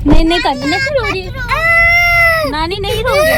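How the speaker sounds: high, loud, crying while soothing; tearful reassurance. Real, not acted. Mother to crying child at farewell, tearful and high.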